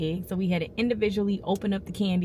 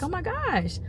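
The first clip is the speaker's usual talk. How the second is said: surprised gasp; 7 semitones higher than usual. Retold or imitated. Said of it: "I was like".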